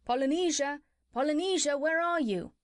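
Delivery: high calling voice for the parrot. Acted. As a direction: call out high into the dark dungeon.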